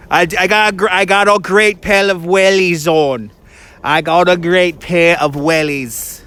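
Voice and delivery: playful mock British accent; put-on British accent. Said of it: "My British accent has definitely improved".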